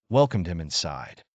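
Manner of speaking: ordinary delivery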